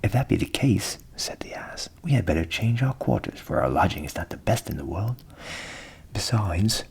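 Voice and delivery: jolly donkey offering a plan; hearty donkey. A narrator's reading. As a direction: invite the cat along warmly. Ass advises moving.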